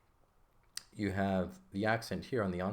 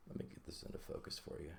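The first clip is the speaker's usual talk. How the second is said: whispered aside; 3 semitones lower, 14 dB quieter, faster than usual. Real, not acted. Muttered aside while adjusting camera.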